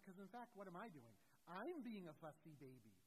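ordinary talk, nothing marked

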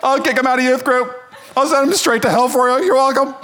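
put-on young pastor caving to an angry parent, groveling; mock eager to please. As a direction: cave in instantly and cheerfully. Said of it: "I was like".

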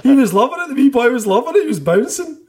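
high, loud and laughing; delighted, high-pitched laughing exclamation; laughing retelling. Real, not acted. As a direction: tell it through laughter, voice shooting right up. Laughing as he tells the story.

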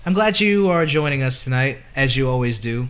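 big show-host welcome; announcer voice. Acted. Podcast host intro voice.

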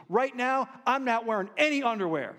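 animated retelling of a stolen-laundry tale; imitating Vic. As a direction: play the homeless friend spinning his sob story, high and loud. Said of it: "he goes".

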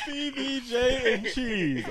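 drawn-out, high, teasing; slow mock announcement. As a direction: savor each word slowly. Mocking repeat of sandwich name.

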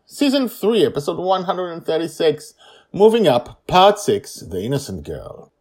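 loud, high episode title read; title announcement. Acted. Host naming the episode, loud announcer read.

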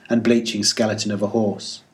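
ordinary delivery, nothing marked

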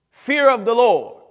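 preacher booming the key phrase; preaching shout. Real, not acted. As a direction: belt it out to the congregation.

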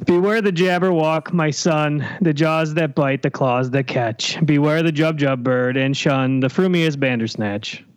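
slow, lofty recital; news broadcaster voice. Acted. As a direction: declaim it like the evening news. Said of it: "With your best news broadcasting voice".

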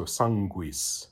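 sanguis said slowly with a GW sound. Shown as a demonstration. Teacher gives students a Latin example, sanguis with GW. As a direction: pronounce the gu in sanguis as gw. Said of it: "a G U is essentially a G W".

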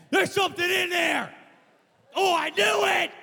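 very high, loud frantic cry; panicked shout. Retold or imitated. Preacher replays finding something in there, panicked shout. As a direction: shriek it in acted alarm.

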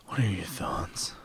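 slow unvoiced whisper; leaning in to whisper. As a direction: lean close and whisper it. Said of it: "Tell me your thoughts".

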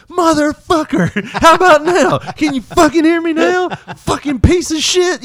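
high, loud yelling into the phone; furious cussing. Retold or imitated. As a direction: reenact a screaming fit at a robot. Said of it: "I just started cussing".

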